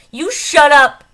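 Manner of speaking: loud, high furious shout; angry shout. A narrator's reading. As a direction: yell it at her. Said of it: "furious outburst at doctor".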